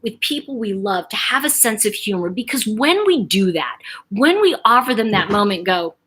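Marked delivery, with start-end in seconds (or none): none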